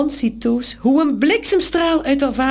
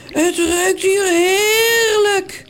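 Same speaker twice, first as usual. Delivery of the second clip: slow, drawn-out relish, pitched up; hungry swoon over a smell; savouring; 5 semitones higher, much slower than usual. Acted. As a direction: draw it out with greedy delight. Morrel smells the soup, licks lips.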